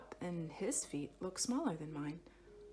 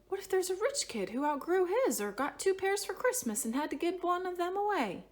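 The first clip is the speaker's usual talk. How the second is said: hopeful excitement; 11 semitones higher, 6 dB louder than usual. A narrator's reading. Sudden hopeful idea.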